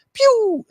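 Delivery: high squeaky pew sound; firework pew. Retold or imitated. Imitating firework noise.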